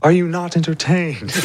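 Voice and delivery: high theatrical challenge to the crowd; mock arena showman. Player quoting a gladiator to the table, theatrical challenge. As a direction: call out a hammed-up taunt, pitched well up.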